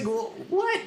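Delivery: high squeaky mock confusion; a sudden high-pitched what; mock baffled. Friends mocking a film narrator, baffled high squeak.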